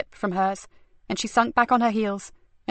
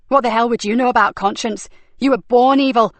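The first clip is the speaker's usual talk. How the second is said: louder furious outburst, rising on evil; exploding in anger; 2 semitones higher, 6 dB louder than usual. Acted. Nicky bursts out at Frankie, furious and loud. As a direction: spit it at her. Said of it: "exploded Nicky".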